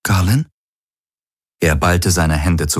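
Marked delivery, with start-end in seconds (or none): 0.0-0.5 puzzled, rising query; a questioning lift on the name; confused question. A narrator's reading. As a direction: ask the name in mild confusion. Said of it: "Efi sah ihn verwirrt an".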